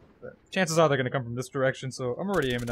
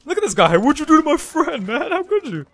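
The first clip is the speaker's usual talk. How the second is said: loud, high-pitched mock indignation; mock outrage; 13 semitones higher, 10 dB louder than usual. Player scolding an enemy for hurting his friend, mock outrage. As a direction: yell in playful protest.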